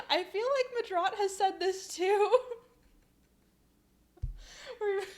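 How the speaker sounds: high, loud squeal after a laughing fit; amused, voice pushed high by laughter. Real, not acted. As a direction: speak while still cracking up. Still cracking up from joke.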